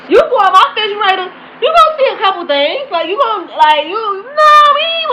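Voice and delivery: high-pitched, loud disbelief; incredulous outburst. Retold or imitated. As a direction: voice shooting up in exasperated disbelief. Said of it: "Then I'm like".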